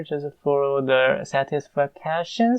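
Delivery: ordinary delivery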